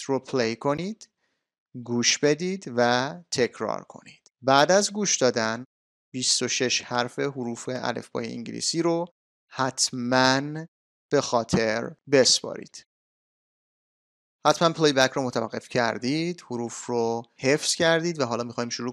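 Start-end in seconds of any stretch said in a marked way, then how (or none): none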